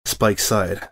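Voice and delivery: ordinary delivery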